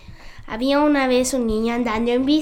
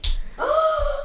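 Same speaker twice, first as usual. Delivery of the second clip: surprised gasp; 13 semitones higher, 5 dB quieter than usual. Real, not acted. Surprise at old series.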